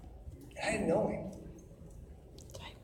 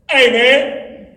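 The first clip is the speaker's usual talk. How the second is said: higher, louder playful call across the yard; calling out to son; 5 semitones higher, 15 dB louder than usual. Retold or imitated. Dad calling down to his son below, playful and louder. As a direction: call down to the kid, teasing. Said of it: "I would just do this".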